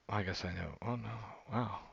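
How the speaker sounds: normal talking voice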